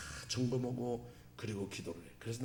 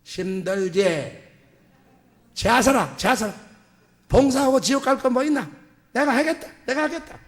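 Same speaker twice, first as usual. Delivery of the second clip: loud, high Gyeongsang dialect scolding; 9 semitones higher, 9 dB louder than usual. Retold or imitated. Ulsan pastor scolding reluctant volunteers in Gyeongsang dialect. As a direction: bark it in thick Gyeongsang dialect. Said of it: "경상도 말로".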